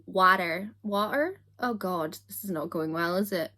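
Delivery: ordinary talk, nothing marked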